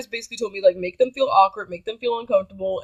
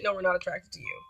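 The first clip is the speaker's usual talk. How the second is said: ordinary delivery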